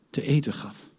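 usual speaking voice

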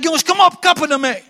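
high, loud order to stop; commanding shout. Retold or imitated. Teenage preacher ordering the classmates to stop, loud shout. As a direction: shout them down and end it.

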